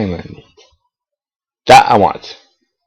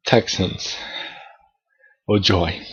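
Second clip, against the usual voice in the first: whispered, much softer dry sarcasm; sarcastic mutter; 3 semitones lower, 11 dB quieter, slower than usual. Retold or imitated. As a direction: mutter it under your breath, unimpressed. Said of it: "I'm like".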